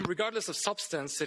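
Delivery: ordinary talk, nothing marked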